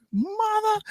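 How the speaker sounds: very high squeaky mock voice; imitating other cop. Other cop in The Wire answering, squeaky mock voice. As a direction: stretch it out high and thin. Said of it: "the other one's like".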